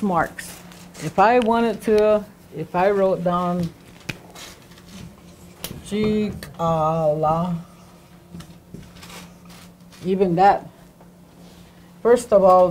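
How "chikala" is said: Chikala split slowly into chi-ka-la; slow syllables. Shown as a demonstration. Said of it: "the word breaks up into syllables".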